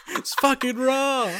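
Gordon Ramsay yell. Retold or imitated. Co-host joins the chef parody, slow hammed yell. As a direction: drag the outrage out like a furious chef. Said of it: "Is it, you, like, were working at an ice cream shop".

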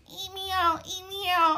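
put-on high, slurred party girl voice. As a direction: squeal it like a wasted teen girl.

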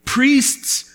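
ordinary talk

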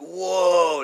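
high, loud whoa; retold wonder, called out; amazed whoa. Retold amazement at flying beings.

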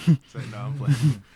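very loud, giggling joke-off; big laugh, just kidding. Real, not acted. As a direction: laugh it off loudly.

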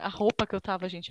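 usual speaking voice

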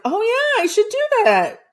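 high, loud mock-eager reply; sarcastic oh yeah.

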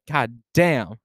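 usual speaking voice

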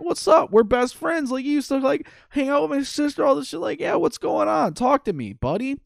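high-pitched pal asking what's wrong; Ralphie's voice. Retold or imitated. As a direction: coax a friend who's dodging you. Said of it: "And he's like".

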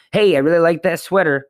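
high and loud, breezy compliment to a stranger; cheery compliment. Shown as a demonstration. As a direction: toss it off brightly and walk away.

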